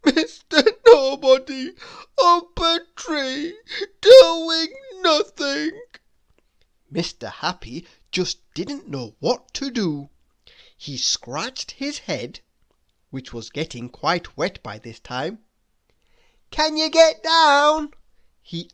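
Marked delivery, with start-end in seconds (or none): 0.1-5.7 hammed-up tearful lament; sobbing. A narrator's reading. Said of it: "he sobbed". 16.5-17.9 high, loud character question; raised, eager character voice; calling up. A narrator's reading. Mister Happy calls up the tree.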